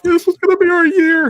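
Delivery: hammed-up tearful cry; imitating a bawling friend. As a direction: wail it like a heartbroken fan. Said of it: "she was just bawling".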